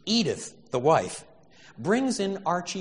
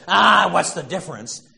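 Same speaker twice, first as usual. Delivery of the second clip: gruff brush-off; 3 semitones higher, 11 dB louder than usual. Retold or imitated. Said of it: "when Archie Bunker says".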